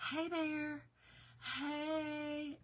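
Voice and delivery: meek, unsure "Hey, bear"; timid bear call. Shown as a demonstration. Mocking the weak way to face a bear, meekly. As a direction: call out softly, too timid to scare anything. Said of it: "I mean, what?".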